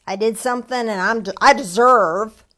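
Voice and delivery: mock entitled voice in a southern accent. Preacher's quote drifting into a southern accent. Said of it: "oh, there's that southern accent".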